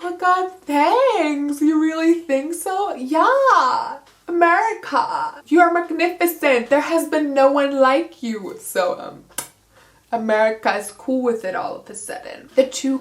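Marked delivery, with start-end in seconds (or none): 0.0-2.9 high, slow, mock-flattered simper; flattered America. America basks in Georgia's praise, high mock simper. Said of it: "And America's like". 2.9-8.6 high, gushing mock praise; gushing Georgia. Georgia reassures America she is magnificent, high gushing voice. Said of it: "America's like".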